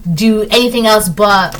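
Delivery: ordinary talk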